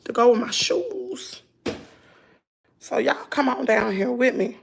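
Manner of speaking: ordinary talk, nothing marked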